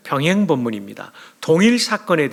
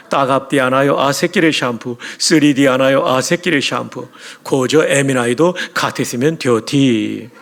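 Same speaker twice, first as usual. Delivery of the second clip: lightly voiced Pyongyang dialect; loud hint of Pyongyang dialect; Pyongyang accent; 3 dB louder than usual. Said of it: "평양 사투리로".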